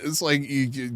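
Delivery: ordinary delivery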